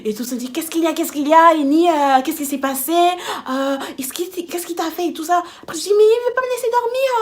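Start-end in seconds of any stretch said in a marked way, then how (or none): 0.5-5.4 loud, raised, rushed-in questioning; alarmed crowd. Retold or imitated. Everyone arriving to ask what happened, alarmed. 6.0-7.1 high, loud, fast wail; tearful yelling. Retold or imitated. Telling everyone he will not let her sleep, tearful yelling. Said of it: "je commence à pleurer".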